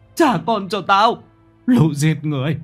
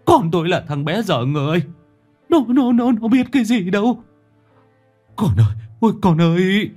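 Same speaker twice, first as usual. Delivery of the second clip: put-on old father keening over his dead son, voice climbing high; lamenting old father rises into a shrieking cry; anguished wailing father; 6 semitones higher, faster than usual. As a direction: wail it out, breaking and desperate. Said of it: "Lão run run rú lên một tiếng, nghe vô cùng thê thiết".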